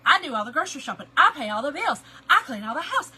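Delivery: fast, loud mock rant to her husband; hammed-up rant. Wife listing chores to her husband, in a loud laughing rant. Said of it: "That's how I would do it".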